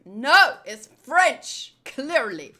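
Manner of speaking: loud, slow punch on NO and FRENCH; insist, hitting FRENCH hard; mock-indignant correction stressing FRENCH; insistent protest. Real, not acted. Protests listener calling accent German.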